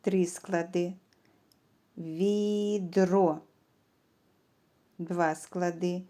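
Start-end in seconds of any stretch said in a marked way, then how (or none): 2.0-3.4 відро drawn out as ві-дро; two separate beats in відро; syllable by syllable. Shown as a demonstration. Teacher splits word into syllables.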